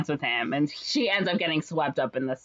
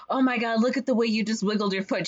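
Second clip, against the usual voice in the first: mock gushing flattery; 5 semitones higher than usual. Flattering salesman voiced in retelling.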